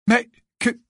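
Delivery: high, loud outraged protest; indignant exclamation. A narrator's reading. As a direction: exclaim at the injustice. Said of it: "s'exclama Harry, furieux de cette injustice".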